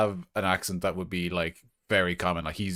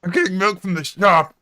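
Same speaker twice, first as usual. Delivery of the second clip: put-on high, loud squeaky village accent; high, loud squeaky accent on "I'm getting milk from the shop"; Green Spot's accent; 9 semitones higher, 8 dB louder than usual. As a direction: squeak it out in his odd accent. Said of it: "Green Spot, can you say in your character's accent".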